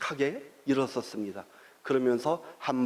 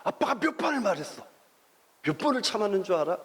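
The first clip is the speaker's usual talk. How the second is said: higher, fast fatherly scolding; exasperated dad; 5 semitones higher, faster than usual. Retold or imitated. Father scolding his son at home, higher and fast. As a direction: snap at your son, voice up high. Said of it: "아들한테 그런 말을 하는 것 같아요".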